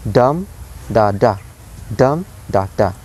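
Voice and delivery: "dum" stressed and lifted, each "da" unstressed; dactylic beat. Shown as a demonstration. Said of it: "the pattern here is high".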